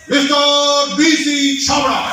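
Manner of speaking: very slow, high, shouted name call; mock announcer shout. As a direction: stretch the name out like a ring announcer. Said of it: "बुलाने के लिए ... बोलने लगा".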